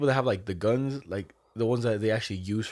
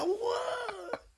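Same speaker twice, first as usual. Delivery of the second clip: very high, soft disbelieving squeak; stunned what; 7 dB quieter than usual. Retold or imitated. Reacting to the friend's excuse, high squeaked what.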